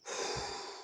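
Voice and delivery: long breathy exhale of release; relieved sigh. Shown as a demonstration. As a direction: let the burden go in one long sigh. Acts out release of burden.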